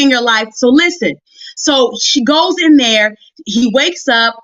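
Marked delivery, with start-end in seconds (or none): none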